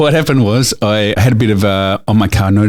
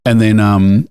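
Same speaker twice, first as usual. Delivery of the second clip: ordinary talk, nothing marked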